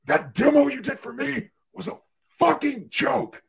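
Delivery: furious surgeon. Retold or imitated. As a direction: sneer it out, dragging the last words. Surgeon berating rep in OR.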